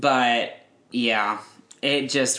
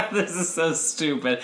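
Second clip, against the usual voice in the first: quoting mom while laughing; 5 semitones higher than usual. Real, not acted. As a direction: giggle out the words, slowly. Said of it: "And my mom said".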